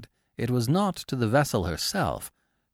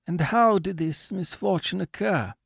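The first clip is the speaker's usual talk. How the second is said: owner inquiring; 9 semitones higher than usual. A narrator's reading. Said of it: "inquired the latter".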